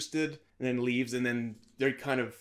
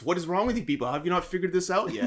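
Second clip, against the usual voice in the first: higher, brisk joking rant; mock exasperation; 6 semitones higher, 4 dB louder, faster than usual. Joking that it takes only being Ryan Gosling, mock exasperated. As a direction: scold everyone in pretend frustration, half laughing.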